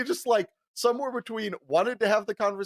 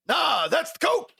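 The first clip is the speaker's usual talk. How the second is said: emphatic; 9 semitones higher, 3 dB louder, slower than usual. Retold or imitated. Driver's deadpan punchline.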